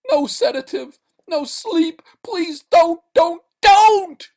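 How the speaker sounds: very high, loud pleading screams rising; building scream. Acted. Man begging against sleep, building scream. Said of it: "screamed Sale".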